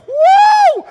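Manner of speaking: very high, loud whoop of joy; excited whoop. Real, not acted. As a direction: let out a big cheer. Shark Week excitement.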